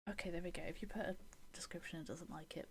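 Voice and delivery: whispered aside to herself; unvoiced muttered whisper. Real, not acted. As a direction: breathe it under your breath. Muttering to self at computer.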